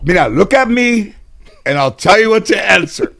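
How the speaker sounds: grandma insisting in court, half-joking; coaching grandmother again. Retold or imitated. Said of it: "So she goes in court".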